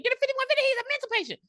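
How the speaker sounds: mock shrill high panic call; high-pitched alarmed demand for help; panicked nurse. Nurse calling for help, mock high panic. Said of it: "She's like".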